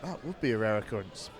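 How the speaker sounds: quiet, dry sarcastic agreement. Real, not acted. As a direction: say it low with a sarcastic edge. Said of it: "I said that too sarcastically".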